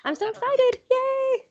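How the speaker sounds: high excited squeal. Real, not acted. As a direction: squeal with joy.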